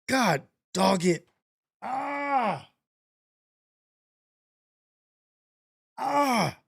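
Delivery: slow, pained "god dog it" and cries; 懊恼地哀叹; frustrated groan. Real, not acted. Frustrated at game.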